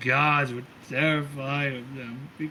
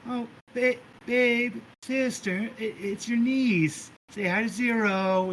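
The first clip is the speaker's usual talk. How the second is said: much higher, cooing voice; gushing sweetly; 8 semitones higher than usual. Acted. Sister cooing to her girlfriend, high gushing voice. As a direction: coo it out like a smitten girlfriend.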